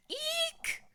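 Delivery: very high whispered eek; squeaky cry. A narrator's reading. Three bears startled by waking girl, high eek. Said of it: "cried the three bears".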